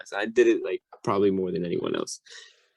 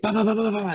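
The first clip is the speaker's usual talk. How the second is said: much higher, quick bah-bah-bah; pitched-up babble of motion noises; rapid "bah" noises; 11 semitones higher, faster than usual. Retold or imitated. Imitating his low crawling.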